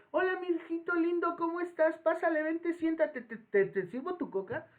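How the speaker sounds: put-on taco lady cooing to a grown customer as if to a child; sweet taco lady. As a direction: coo at him like a doting grandma. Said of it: "como si fueras una criatura de poca edad".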